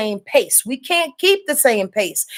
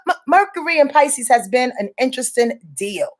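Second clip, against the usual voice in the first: ordinary talk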